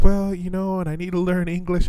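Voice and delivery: high mock pleading voice; imitating her. Retelling her coaxing him for lessons, high mock voice. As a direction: play her coaxing in a lifted voice. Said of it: "to come back and be like".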